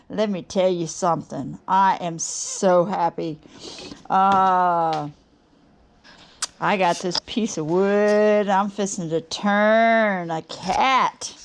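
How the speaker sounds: normal talking voice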